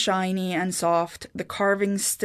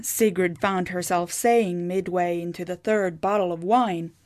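plain reading voice

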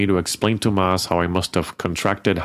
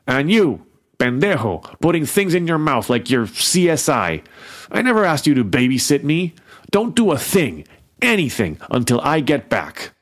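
yelling; 6 semitones higher than usual. A narrator's reading. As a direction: chew him out hard. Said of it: "But then Maz yelled at me too".

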